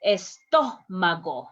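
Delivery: "estómago" slowly, with a strong, held TÓ; "estómago" as es-TÓ-ma-go, slow and falling; stress on accented vowel. Shown as a demonstration. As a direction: lean hard on TÓ in "estómago", then fall away. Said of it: "where your voice has to be strong".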